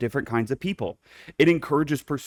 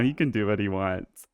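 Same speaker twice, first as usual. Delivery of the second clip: ordinary talk, nothing marked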